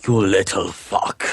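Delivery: slow, growled put-on voice; gruff character voice. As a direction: snarl it slowly in a character voice. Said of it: "That's not Brandon's voice either".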